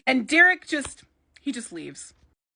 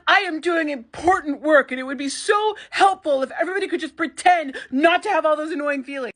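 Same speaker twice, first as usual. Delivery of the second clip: mock yell; 5 semitones higher than usual. Derek yelling at Ivy about her feelings, hammed-up rant. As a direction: rant like an exasperated director. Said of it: "he kind of yells at her about having feelings".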